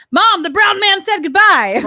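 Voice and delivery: put-on high, slow kid's shout; loud as possible. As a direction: imitate a small child announcing it to everyone. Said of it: "as loud as he could".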